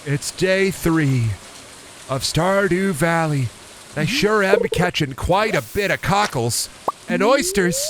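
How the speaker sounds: drawn-out, lilting intro voice; slow sing-song game voice. Acted. Streamer opening the farming game, slow and lilting. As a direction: ease into it slowly, like a cosy show host.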